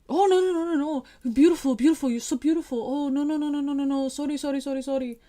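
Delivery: high, loud, rushed mock pleading; frantic apologizing. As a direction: imitate someone frantically backpedalling in a high voice. Mimics man frantically backpedalling after accusation.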